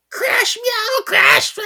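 loud, very high cartoon sound effects; imitated crash. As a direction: shriek out crashes and a cat's cry. Imitating offstage crash and cat noises.

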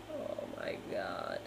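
low, whispered swoon; awed whisper. Real, not acted. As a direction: whisper it, blissful at the thought of pie. Savoring memory of key lime pie.

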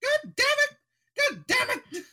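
falsetto mock-royal cursing; the Queen. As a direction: curse in a very high, hammed-up monarch voice. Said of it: "she's like".

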